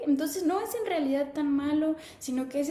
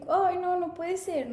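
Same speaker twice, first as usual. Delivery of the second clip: retold dismay; 6 semitones higher, 3 dB louder, slower than usual. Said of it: "ahí fue cuando yo dije".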